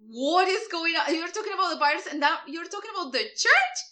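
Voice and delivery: loud, high, baffled viewer reaction; incredulous. Retold or imitated. As an audience hearing about the church, acted incredulous. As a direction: protest the twist in rising disbelief.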